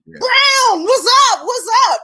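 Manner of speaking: high, loud, calling out in welcome; excited greeting. Retold or imitated. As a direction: shout a happy hello across the street.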